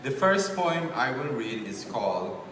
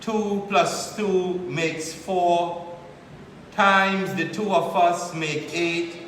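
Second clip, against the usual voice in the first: measured, louder spoken-word poem delivery; higher, projected poetry reading voice; poetry recitation; 7 semitones higher, 8 dB louder, slower than usual. Acted. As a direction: recite it line by line with deliberate pauses.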